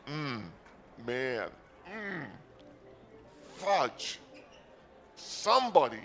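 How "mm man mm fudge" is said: ordinary delivery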